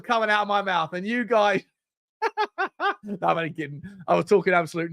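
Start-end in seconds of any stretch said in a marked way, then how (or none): 2.2-3.0 teasing laugh. Real, not acted. Laughs after teasing the viewers.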